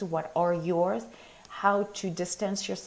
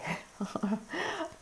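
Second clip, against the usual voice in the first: soft laugh; 10 semitones higher, 7 dB quieter than usual. Real, not acted. Light laugh while signing off.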